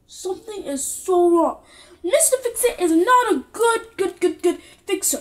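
usual speaking voice

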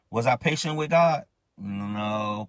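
ordinary talk, nothing marked

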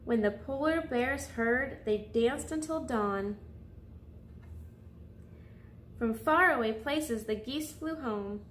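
gentle storybook voice for little listeners; soothing picture-book narration; reading to children. Acted.

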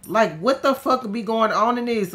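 high, loud outburst; exasperated yell. Real, not acted. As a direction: throw up your hands out loud. Game chaos.

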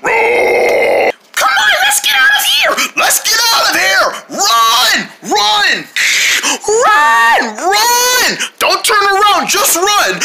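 high, frantic yelling to run; panicked shouting. Acted. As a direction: scream at them to run.

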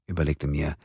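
ordinary delivery, nothing marked

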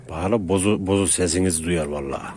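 ordinary talk, nothing marked

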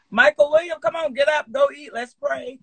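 loud, high call down the hall; mother shouting her son's name to get him up, loud and high. Retold or imitated. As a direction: yell the name to wake him up. Mother calling sons out of bed.

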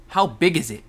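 shouted question. Pretend. Gamer asking himself about the lava, loud shouted question. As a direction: call it out like a hype question.

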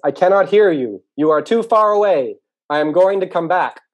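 high, loud holler across a distance; calling back loudly. Acted. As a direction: shout it slowly so someone far off can hear. Mole calls back from far away.

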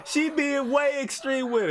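giggling through the words; laughing high. Real, not acted. Reactor laughing at the extreme girl. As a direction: crack up while saying it.

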